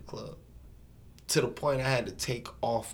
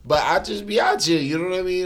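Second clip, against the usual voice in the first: hyped up; 7 semitones higher, 9 dB louder than usual. Real, not acted. Hyped boasting about club game.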